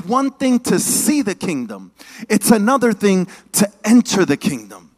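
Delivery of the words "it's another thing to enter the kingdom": loud, rising sermon build; preaching at full force. Acted. Preacher driving the point home to the congregation, full force. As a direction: preach it out to the crowd.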